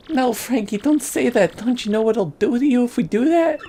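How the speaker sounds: high-pitched worried sweetheart; pleading girlfriend. Acted. Daughter begs boyfriend not to go back, high pleading voice.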